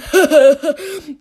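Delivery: short burst of laughter; laughing at own joke. Real, not acted. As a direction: crack up at the punchline.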